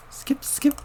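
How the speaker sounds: breathless whisper. Acted. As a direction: whisper it under your breath. Unvoiced whispered line.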